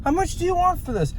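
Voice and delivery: very high, light put-on voice; put-on polite asking. Shopper asking seller the price, put-on high voice. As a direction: ask in an exaggerated high little voice.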